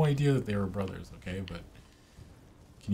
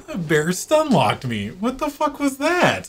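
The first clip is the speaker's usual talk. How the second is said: high, loud laughing disbelief; shocked outburst; 9 dB louder than usual. Real, not acted. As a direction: yelp it out, stunned and laughing. Bear stun-locked him.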